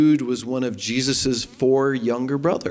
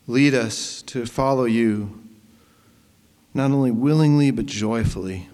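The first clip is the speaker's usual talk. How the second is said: prayer; 3 semitones lower than usual. Acted. Leads a prayer.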